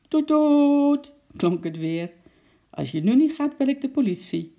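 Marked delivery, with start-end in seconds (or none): 0.1-1.1 short then long high honk; toeter nadoen. A narrator's reading. Toeter klinkt weer. 2.7-4.5 high, raised nervous call; a touch of panic, pitched up; zenuwachtig roepend. A narrator's reading. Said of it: "riep de mevrouw zenuwachtig".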